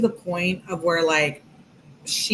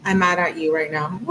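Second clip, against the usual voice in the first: ordinary talk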